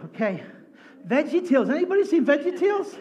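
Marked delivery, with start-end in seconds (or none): 1.1-3.0 high, loud call to the crowd. Real, not acted. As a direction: shout the question out to the room. Calling out to congregation.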